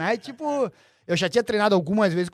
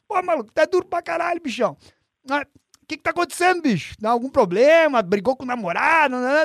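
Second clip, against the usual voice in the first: high, teasing Renzo impression, quicker on the question; Renzo's way of talking; 6 semitones higher than usual. The coach Renzo teasing his student about a breakup, high and playful. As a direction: tease like Renzo, pitched up. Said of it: "aquele jeito do Renzo".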